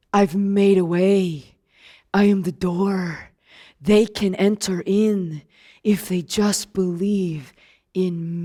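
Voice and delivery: low, soft and slow, every word placed; calm tender reassurance; gentle Jesus. Acted. Jesus sending his disciples out, soft slow tender.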